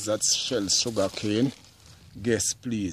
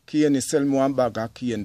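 calling the cane man, a bit higher and louder; calling out to the vendor; 3 semitones higher, 5 dB louder than usual. Retold or imitated. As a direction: hail the vendor across the street. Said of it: "So they would say".

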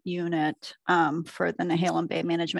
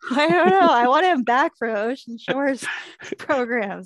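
giggling through the words; laughing while talking; 6 semitones higher, 6 dB louder than usual. Real, not acted. Teased about keeping name secret.

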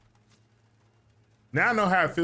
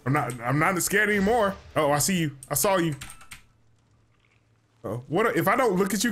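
ordinary delivery

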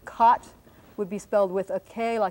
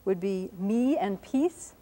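ordinary talk

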